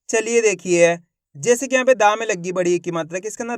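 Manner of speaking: usual speaking voice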